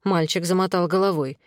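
ordinary delivery

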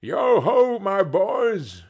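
loud, high, hearty hail; jolly old master rallying his workers; jovial Fezziwig. A narrator's reading. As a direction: call the lads merrily in a rich voice. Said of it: "called out in a comfortable, oily, rich, fat, jovial voice".

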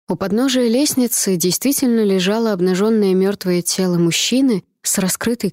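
ordinary delivery

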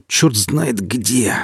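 slow, lightly strangled voice; strained, choked. A narrator's reading. Stalker seized by sudden pain, slow and strained. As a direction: squeeze the words out slowly. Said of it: "произнёс вдруг сдавленно".

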